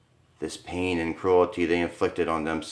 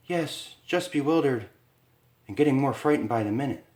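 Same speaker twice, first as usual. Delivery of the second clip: higher, rushed frightened wife; frightened Lisa; 6 semitones higher than usual. Acted. Wife to husband in the cell, higher rushed frightened voice. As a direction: fear rising with each word. Lisa's voice, growing fear.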